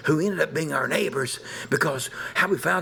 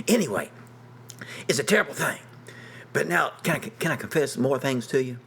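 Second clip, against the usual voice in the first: ordinary talk, nothing marked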